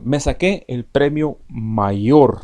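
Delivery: slow, emphatic Me saqué el premio mayor, saqué stressed; expressive example. Shown as a demonstration. Teacher reads book example, slow and emphatic. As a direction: draw it out slowly, leaning on saqué. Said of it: "Ese está en su libro".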